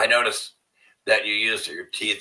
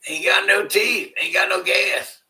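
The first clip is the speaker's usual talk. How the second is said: hillbilly voice; 9 semitones higher, 4 dB louder than usual. Pretend. Joking toothless singsong.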